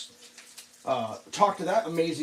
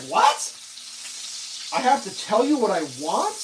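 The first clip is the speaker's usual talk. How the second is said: incredulous what; 4 semitones higher than usual. Retold or imitated. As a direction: sound floored by the request. Shocked at agent's request.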